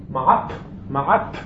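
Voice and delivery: slow מעט with a tongue-struck final T; struck tet. Shown as a demonstration. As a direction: strike the palate behind the upper teeth on the T of מעט. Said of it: "הטית יוצאת מלתת מכה פה".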